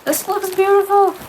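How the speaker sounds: high, loud burst of delight; excited gasp of delight. Real, not acted. Crafter opening a handmade shawl, excited high delight. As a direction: burst out in real delight at the gift. First look at the gift.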